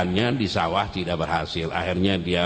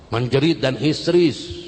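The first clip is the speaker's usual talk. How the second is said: emphatic, less loud repeat; 5 semitones higher, 4 dB louder than usual. Shown as a demonstration. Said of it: "langsung menjerit".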